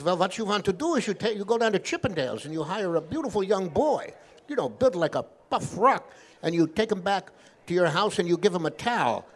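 put-on rabbi advising in a Yiddish accent; Yiddish-accented joke rabbi laying out a plan. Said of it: "This is my Yiddish accent".

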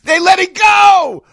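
high, slow, exasperated yell; outraged shout. Real, not acted. Host outraged the networks let it go, shouting. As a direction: cry out in disbelief.

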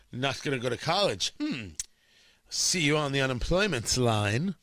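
a hint of a haughty administrator; imagined university official. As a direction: play a dismissive university talking down to a dropout. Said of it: "I'm assuming they talk like that".

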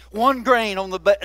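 ordinary talk, nothing marked

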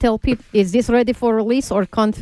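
faltering mock Russian newscaster; Russian accent. Newscaster unsure of incoming wire news, halting Russian accent. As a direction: stumble through it in a put-on Russian accent. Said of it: "you do the Russian accent".

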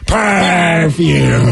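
silly drawn-out "PERRR-fume"; hammed-up purring perfume. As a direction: purr "perfume" at full stretch. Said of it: "What's my wife's".

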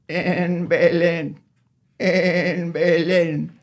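slow, quavering high bleat; goat bleat. Acted. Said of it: "And the goat bleated".

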